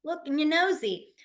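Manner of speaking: high, light teasing; high playful tease. Retold or imitated. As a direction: playfully call them out. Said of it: "I'll always be like".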